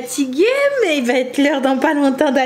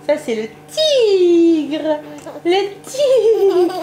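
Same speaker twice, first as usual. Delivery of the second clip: put-on talking to a baby; 4 semitones higher, much slower than usual. Showing toy to baby.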